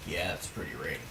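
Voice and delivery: low, quiet impression of a strange man; creepy stranger. Nosy stranger cutting in behind the family, low creepy mutter. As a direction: mimic a man butting in, low and under the breath. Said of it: "we hear this dude go".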